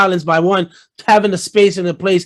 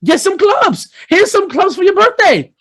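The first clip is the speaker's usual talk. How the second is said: high, sarcastic imitation of a dismissive boss; lightly mock a condescending woman, higher; high sneering mock quote, ending slow; 9 semitones higher, slower than usual. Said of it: "the woman that got you be like".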